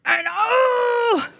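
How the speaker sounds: loud, high cry of frustration; frustrated cry. Retold or imitated. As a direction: let out a shout of exasperation. Rage rising in the car.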